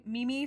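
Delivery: "Mimi" said MEE-mee, two syllables; MEE-mee mispronunciation. Retold or imitated. Teasing co-host about an old mistake, "Mimi" as MEE-mee. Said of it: "how you used to say".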